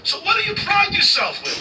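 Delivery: very high, loud, mocking challenge; high loud contemptuous demand; scornful shout. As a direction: sneer it loudly at the modern Italian. Ancient Roman challenging modern Italy.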